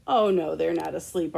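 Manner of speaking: ordinary delivery, nothing marked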